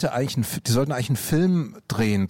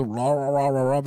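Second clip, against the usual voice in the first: mock wordless singing, rolled out loosely; nonsense-syllable singer imitation. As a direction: imitate a singer filling in missing lyrics. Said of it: "der macht doch auch immer so".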